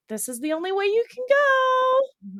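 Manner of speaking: lightly hammed-up herding voice guiding the horse; voicing the trailer setup. They corner the loose mustang, high sing-song herding voice.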